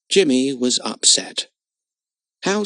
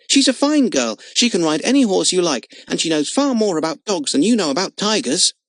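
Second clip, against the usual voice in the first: loud, higher heated defence; angry; 6 semitones higher, 3 dB louder than usual. Acted. Jimmy argues with Frick about Lotta, heated loud. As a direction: keep arguing hotly for her. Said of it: "cried Jimmy angrily".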